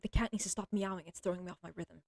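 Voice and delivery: normal talking voice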